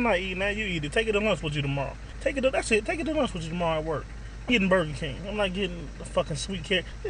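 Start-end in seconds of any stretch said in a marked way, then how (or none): none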